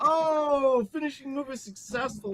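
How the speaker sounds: high, loud drawn-out "oh" into the call; excited announcer call. Acted. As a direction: call the big move like a wrestling announcer. Game master announces successful finishing move.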